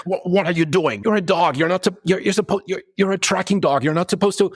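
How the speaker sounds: high, exasperated scolding of a dog; exasperated orc. Acted.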